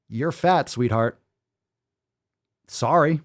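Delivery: normal talking voice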